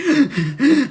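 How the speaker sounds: sharp mock gasp for breath, rising; imitated gasp. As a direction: gasp like mum struggling to breathe. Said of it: "and she's like".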